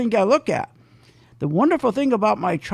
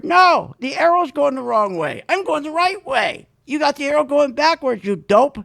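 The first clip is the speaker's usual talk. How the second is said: retold retort; 7 semitones higher, 3 dB louder than usual. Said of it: "I said to her".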